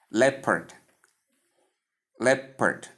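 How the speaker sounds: "Leopard" as LEP-erd, two separated syllables, falling; model pronunciation of leopard. Shown as a demonstration. Teacher leading learners in practice, "Leopard" carefully as LEP-erd. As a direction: model "Leopard" with short e and schwa. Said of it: "I want you to practice with me".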